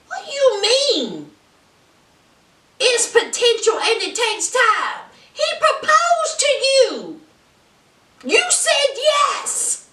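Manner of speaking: high-pitched, incredulous protest; worked-up, high indignation; indignant disbelief. Real, not acted. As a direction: call her out in exasperated disbelief. Indignant reaction to Natalie's answer.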